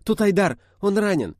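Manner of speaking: high, loud cries of alarm; short-winded shout. A narrator's reading. Nastya reports a wounded friend, high cry. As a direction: cry out urgently while winded. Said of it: "одышливо крикнула Настя".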